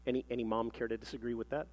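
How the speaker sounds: low, soft, quick undertone; wry aside. Real, not acted. Preacher checks with the mothers listening, wry quiet aside. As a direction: step out of the reading confidentially.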